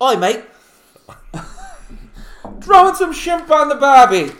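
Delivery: over-the-top Australian yell; mock Australian. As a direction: shout it like a cartoon Aussie. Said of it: "totally went Australian there".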